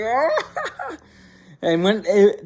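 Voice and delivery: high, imitated laughing outburst; burst of laughter. Son cracking up at his stoned mom, imitated burst of laughter. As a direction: act out breaking into a laugh. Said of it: "I broke out laughing".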